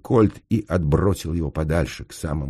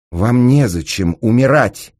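high, loud, drawn-out cry; shouted plea; 7 semitones higher, 9 dB louder than usual. A narrator's reading. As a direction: shout to talk them out of it. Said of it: "крикнул он". (громкий крик-мольба)

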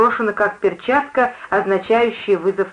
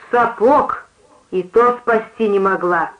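usual speaking voice

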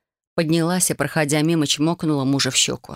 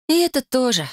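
higher, mildly scornful retort; фыркая; 8 semitones higher than usual. A narrator's reading. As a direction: toss it off with a snort in the voice. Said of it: "фыркнула она". (фыркающим, чуть презрительным тоном)

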